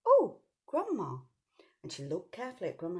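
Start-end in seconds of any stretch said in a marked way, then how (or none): 0.1-1.2 put-on higher, slow startled girl; surprised child. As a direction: stop short, puzzled. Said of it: "she went".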